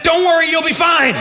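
breezy reassurance. Retold or imitated. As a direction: wave off the worry, too cheerfully. Pastor brushing off hot water.

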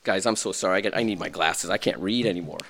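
low, soft apology; sheepish aside. Real, not acted. Pastor apologizing for needing glasses, soft and low. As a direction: mumble an apology.

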